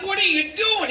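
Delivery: high, loud outraged yell; appalled shout. Acted. Father catches son with grandmother, appalled shout. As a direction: shout it in horrified disbelief.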